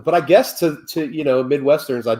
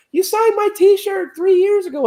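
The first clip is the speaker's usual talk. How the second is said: much higher, imitating an eager kid; kid fan; 3 dB louder than usual. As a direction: play the excited little fan. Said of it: "there are kids like".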